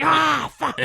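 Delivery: high, loud yelp of pain from a hot panel. Retold or imitated. Burning his hand on the mower, a high loud yelp. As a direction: yelp it as if burnt. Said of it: "and I was like".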